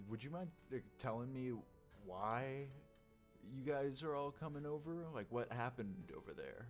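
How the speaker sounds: ordinary talk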